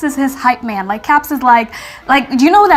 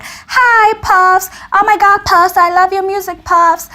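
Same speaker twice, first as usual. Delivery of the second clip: gushing fans; 6 semitones higher, slower than usual. Pretend. Imagined fans greeting a rapper.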